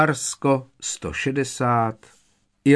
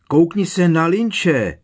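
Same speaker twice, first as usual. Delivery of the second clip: higher, louder, slow yell; 7 semitones higher, 6 dB louder than usual. A narrator's reading. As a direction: shout it to point him out. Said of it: "zaječel Harry". (ječivě, naléhavě)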